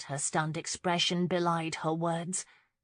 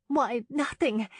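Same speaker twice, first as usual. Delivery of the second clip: high, slow, loud denial; flustered young woman covering up; nervously insisting; 7 semitones higher, 5 dB louder, slower than usual. A narrator's reading. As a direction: insist all is fine, pitched up. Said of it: "she insisted".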